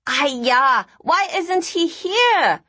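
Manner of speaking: high, slow scolding; admonishing. Acted. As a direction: scold him like an auntie. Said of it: "Nina admonished".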